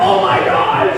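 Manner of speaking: loud, hammed-up scream of alarm; big comic panicked yell; shocked passengers' cry. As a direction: shriek it in mock horror. Acting out horrified passengers.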